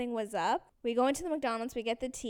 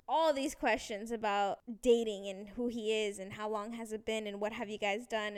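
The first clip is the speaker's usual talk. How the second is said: usual speaking voice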